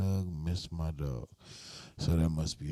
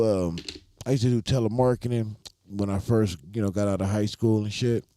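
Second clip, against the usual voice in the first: ordinary delivery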